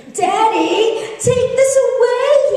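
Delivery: high, slow sing-song whine; whining toddler. Retold or imitated. Toddler whining to his busy dad, high sing-song. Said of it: "he whined".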